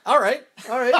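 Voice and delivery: high, loud, eager agreeing; hammed-up eager car dealer. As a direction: jump at the offer.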